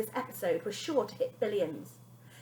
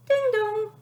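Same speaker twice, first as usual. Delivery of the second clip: high sung doorbell chime, louder; doorbell ding dong; 13 semitones higher, 7 dB louder than usual. Acted. Narrator imitating the doorbell, high and loud. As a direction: sing it like a doorbell.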